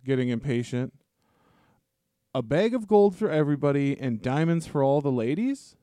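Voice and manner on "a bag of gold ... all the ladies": normal talking voice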